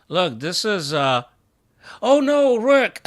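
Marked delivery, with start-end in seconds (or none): 2.0-3.0 high, loud dismayed yelp; anguished cry. Real, not acted. Chess streamer yelping as his rook is lost.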